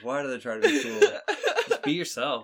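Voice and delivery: high squeaky laughing delivery; laughing motto. Pretend. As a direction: giggle through a mock life lesson. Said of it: "I think that's a better life lesson".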